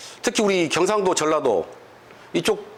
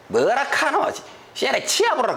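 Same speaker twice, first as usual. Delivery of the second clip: Gyeongsang accent, higher and punchy; Gyeongsang dialect retort; 8 semitones higher than usual. Shown as a demonstration. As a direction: bark it back like a brusque Gyeongsang man. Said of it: "경상도에서 뭐라고 할까요".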